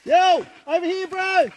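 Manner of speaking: loud high call across the bush. Real, not acted. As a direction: shout for your mates to come over. Calling mates across the bush.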